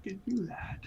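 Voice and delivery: sneaky stage whisper. Pretend. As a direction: whisper it like hiding.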